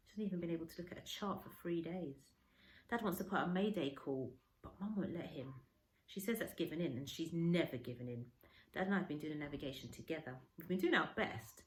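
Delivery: usual speaking voice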